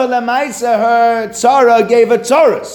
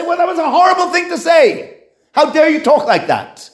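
higher, with a shout on horrible, indignant scolding; mock rebuke; 9 semitones higher than usual. Teacher acting out a scolding to the nasty one, mock angry shout. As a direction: tell off the bully, hammed-up and indignant.

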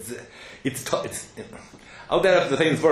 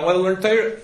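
put-on young man begging his father to let him learn; pleading son; 5 semitones higher, faster than usual. Lecturer voicing the weeping son, pleading.

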